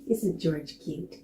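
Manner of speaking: usual speaking voice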